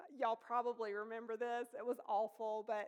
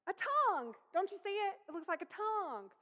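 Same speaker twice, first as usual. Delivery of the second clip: excited discovery; 7 semitones higher, 5 dB louder than usual. Retold or imitated. Excited discovery of leaf shape.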